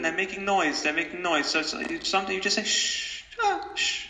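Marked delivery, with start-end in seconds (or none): 2.6-4.1 hushing. Pretend. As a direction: hush them like noisy kids. Hushing noisy thoughts like children.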